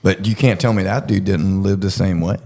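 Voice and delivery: normal talking voice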